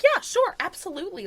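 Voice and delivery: high-pitched imitation of eager consent; mock-chirpy yes; over-eager agreement. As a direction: play her as far too willing, voice jumping up. Acts out suspect agreeing readily.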